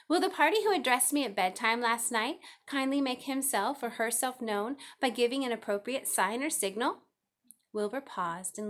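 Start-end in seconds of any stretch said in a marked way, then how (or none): 0.1-7.0 louder, formal announcement; loud and firm. A narrator's reading. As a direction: address the barn like a town crier. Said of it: "in a loud, firm voice".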